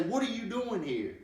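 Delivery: agitated mock yell. Shown as a demonstration. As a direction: act annoyed at an unwelcome party guest. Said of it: "you would yell at them and say".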